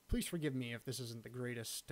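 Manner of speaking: normal talking voice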